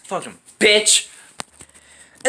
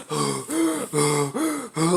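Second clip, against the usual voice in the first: a long ragged gasp for air, softer; exhausted gasp; 7 semitones lower, 9 dB quieter than usual. Retold or imitated. Runner on the track gasps for breath after three laps. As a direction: act out gasping after running laps. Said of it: "I'm just like".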